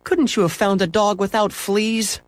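high, plaintive complaint; moaning mother.

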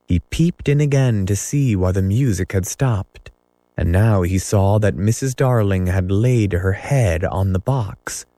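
usual speaking voice

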